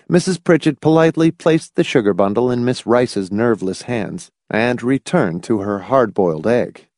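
ordinary delivery

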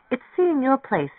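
put-on high-pitched, fed-up young woman's voice; exasperated young woman. A narrator's reading. Mary Ann tells him about the fool she lives with, high and exasperated. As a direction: lift the voice high and complain.

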